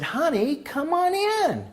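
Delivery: put-on high, slow sweet old lady voice; imitating Mary. Patient greeting her therapist, high sweet voice. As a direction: drawl it up high like a sweet grandma. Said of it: "she calls me honey now".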